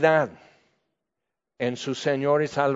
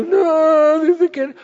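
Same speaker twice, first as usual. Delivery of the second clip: put-on much higher, louder whiny kid voice; mock whining child; 13 semitones higher, 7 dB louder, much slower than usual. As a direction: whine like a hurt little brother. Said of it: "Y tú chillando y todo eso".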